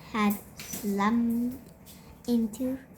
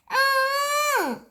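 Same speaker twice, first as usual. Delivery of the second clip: very high wailing yell; 14 semitones higher, 3 dB louder than usual. A narrator's reading. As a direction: yell out in alarm. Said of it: "yelled Peter".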